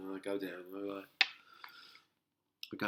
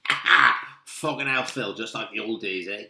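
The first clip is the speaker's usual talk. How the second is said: gleeful Belfast dad; 2 semitones higher, 11 dB louder than usual. Retold or imitated. Passing dad slaps arm, jokes.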